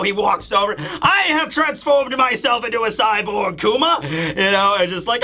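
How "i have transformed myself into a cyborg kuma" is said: raised, declamatory villain voice; Bakori as cyborg. Retold or imitated. Villain revealing his cyborg body to Kuma, grandly. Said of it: "Bakori shows up and he's like".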